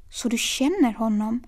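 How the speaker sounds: ordinary delivery, nothing marked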